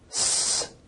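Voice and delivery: "S" as a long hiss with no voicing; voiceless s. Shown as a demonstration. Teacher producing two sounds in succession, "S" as a drawn-out voiceless hiss. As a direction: hold "S" as pure air, vocal cords still. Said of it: "so it's a voiceless sound".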